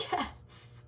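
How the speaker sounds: hissed, voiceless little cheer; whispered triumph. Retold or imitated. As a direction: celebrate under your breath. Acting secret triumph at friend crying.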